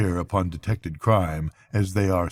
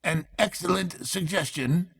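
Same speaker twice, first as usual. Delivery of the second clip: higher, soft approving magistrate; 9 semitones higher, 5 dB quieter than usual. A narrator's reading. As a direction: nod it through with relish. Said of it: "said the chairman approvingly".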